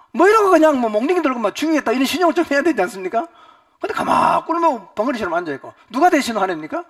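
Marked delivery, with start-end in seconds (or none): 0.1-3.3 high, loud enraged yelling; furious father. Retold or imitated. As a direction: storm like a furious father with a club.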